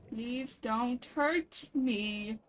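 very slow, stretched out plea; drawn-out plea to a creature. Pretend. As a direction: drag every word out gently. Pleading with a creature.

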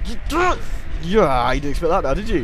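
hammed-up victorious taunting; triumphant battle shout. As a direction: gloat at full volume over a beaten foe. Crowing as enemy is hit.

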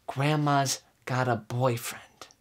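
ordinary talk

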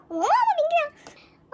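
high, drawn-out delighted squeal; fan squeal. Real, not acted. Fan gushing over a member's photo, squealing. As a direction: squeal the name, stretched out and high.

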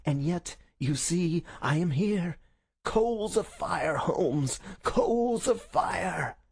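high, projected, drawn-out gloating; gloating visitor Smith. Acted. Visitor crows over Holmes at bedside, gloating. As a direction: crow over the sick man, stretching key words.

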